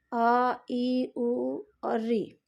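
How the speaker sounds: अ, ई, उ और री said slowly, one by one; teacher modeling अ, ई, उ और री; slow vowel demo. Shown as a demonstration. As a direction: spell out each vowel slowly for the class. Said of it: "ये ह्रस्व स्वर भी है".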